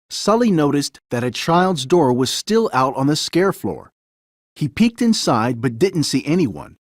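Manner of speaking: ordinary delivery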